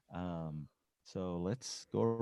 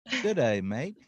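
Australian accent; 2 semitones higher, 4 dB louder than usual. Shown as a demonstration. Host repeating it for the guest who didn't hear, Australian accent. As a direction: repeat the greeting in a playful Aussie accent. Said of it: "my down under accent".

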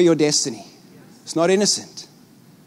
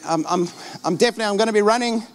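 rushed excuse; faster than usual. Retold or imitated. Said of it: "you're like".

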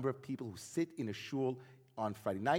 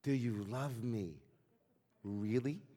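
slow, softer, drawn-out asking; insistent question; 3 semitones lower, 6 dB quieter, slower than usual. Retold or imitated. Ivan asks his best friend once more, slow and insistent. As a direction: press for a sincere answer, slowly and quietly. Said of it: "insisted Ivan".

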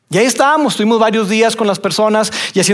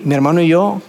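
ordinary delivery

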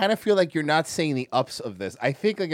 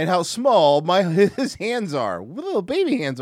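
slow mock awe; 4 semitones higher, 5 dB louder, slower than usual. Mocking son's small hands.

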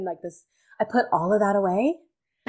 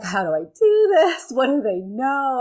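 panicked inner voice; 5 semitones higher, 6 dB louder than usual. Retold or imitated. Panicked thoughts before teaching class.

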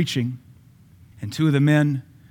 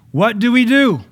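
high, loud rhetorical cry; shouted sermon question; preaching shout; 10 semitones higher, 8 dB louder than usual. Real, not acted. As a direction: belt the question out to the church.

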